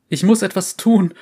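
frantic. Acted. Frantic survivor in interrogation.

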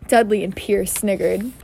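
ordinary delivery, nothing marked

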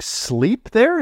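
high, slow disbelieving rise on sleep there; surprised question. Retold or imitated. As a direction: stretch it out in amazed disbelief.

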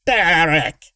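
high, loud trilling cry; shrill trill. A narrator's reading. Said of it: "The voice rose in a shrill trill".

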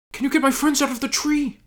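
high, breathless hobbit plea; high pleading voice. Acted.

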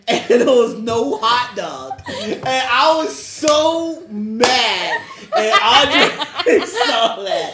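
can barely get it out for laughing; laughing helplessly at own embarrassment; laughing through it. Real, not acted. Laughing while telling embarrassing story.

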